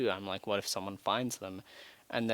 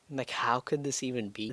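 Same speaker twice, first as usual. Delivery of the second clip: normal talking voice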